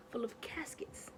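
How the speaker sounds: hushed, breathy reveal of the caskets; whispered for suspense; spooky whisper. Real, not acted. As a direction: drop to a whisper for the creepy part. Spooky reveal in funeral home.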